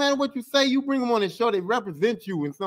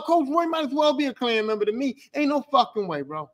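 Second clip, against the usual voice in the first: normal talking voice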